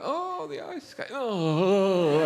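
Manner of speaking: lightly hammed-up adoration; mock swooning fan. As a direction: coo like a figure-skating devotee. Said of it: "people go".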